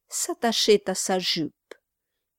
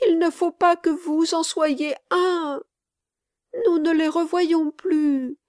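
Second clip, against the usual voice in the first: put-on high, urgent little boy's plea; raised, childlike voice, insistent and pleading; child crying out; 9 semitones higher, 5 dB louder than usual. A narrator's reading. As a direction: implore like an upset small child. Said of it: "s'écria-t-il".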